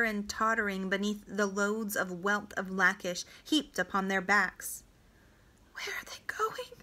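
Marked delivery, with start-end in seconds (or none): 5.7-6.7 put-on hushed, high whisper of a frightened child; soft childlike whisper; whispered question. A narrator's reading. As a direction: whisper it softly, like a small boy. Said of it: "whispered Sabi".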